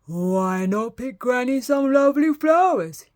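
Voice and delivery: put-on mild wolf voice tempting a child; sly wolf. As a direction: coax her like a sneaky wolf. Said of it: "he said".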